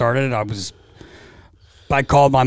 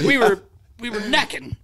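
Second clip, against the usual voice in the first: higher, stammering Roger voice; a higher, halting Roger voice; imitating Roger; 9 semitones higher than usual. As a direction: stumble over it like a flustered old coach. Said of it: "He was like".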